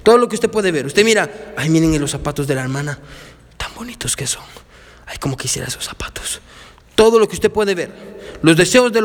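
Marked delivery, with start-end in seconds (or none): 1.6-4.4 soft, breathy longing over shoes; mock envious sigh, trailing to a whisper; envious churchgoer. Pastor voicing a churchgoer who covets shoes, in a soft envious sigh. 5.1-6.4 unvoiced envious whisper; whispered longing. Pastor acting a covetous churchgoer, whispering her wish.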